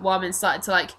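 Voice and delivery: usual speaking voice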